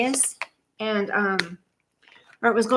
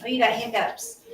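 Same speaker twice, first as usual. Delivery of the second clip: ordinary talk, nothing marked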